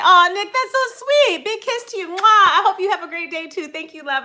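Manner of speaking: high, loud gushing greeting. Real, not acted. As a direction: gush over a viewer. Blowing kiss to viewer.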